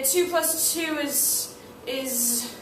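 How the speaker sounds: ordinary talk, nothing marked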